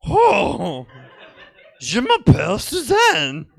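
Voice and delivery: mocking the French tape. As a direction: overdo the French tape lady to mock it. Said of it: "I was mocking the tape".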